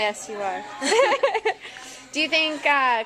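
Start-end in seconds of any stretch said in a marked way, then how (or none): 0.7-2.1 bright high burst of laughter; real amused laugh. Real, not acted. Giggling after a teasing answer. 2.1-3.1 laughing. Real, not acted. Still laughing while asking.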